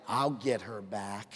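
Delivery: a husband quietly nursing a grudge; grudging mutter. Retold or imitated. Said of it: "Sure you have".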